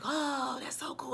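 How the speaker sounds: breathy whisper of wonder, barely voiced; hushed little gasp of awe; awed whisper. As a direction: whisper it in amazement. Amazed watching the effect.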